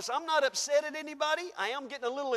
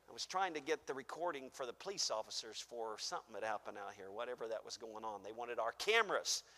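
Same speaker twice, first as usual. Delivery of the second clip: much softer, low side remark; quiet aside; 12 semitones lower, 11 dB quieter, faster than usual. Real, not acted. Pastor explaining his lateness to the church, much softer. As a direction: drop away and chat aside. Off-mic aside about police.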